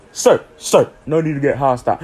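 mock polite official. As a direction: talk him down like a clerk. Official calming him.

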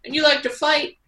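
high, far-off mock boss voice; lightly voiced backstage boss; coaching a wrestler.

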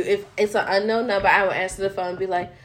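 ordinary talk, nothing marked

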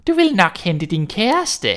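mocking witch. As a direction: sneer at the prince. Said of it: "sagde hun spottende".

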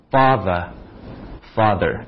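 father with open ah, slow; father said with a broad ah twice; father demo. Shown as a demonstration. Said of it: "有一个特例".

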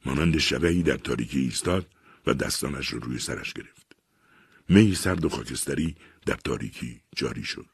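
ordinary delivery

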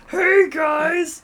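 put-on high shouted party-girl voice; loud funny-girl impression. Mocking an overweight funny girl yelling to her friends, high and loud. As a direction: shout it up high like the loud girl who thinks she's hilarious. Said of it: "the funny girl in the group".

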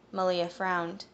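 plain reading voice

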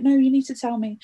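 insistent dad. Retold or imitated. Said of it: "he was like".

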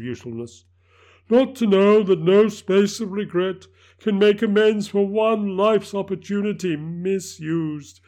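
high, loud anguished spirit; unearthly voice. Acted. Ghost's anguished lament.

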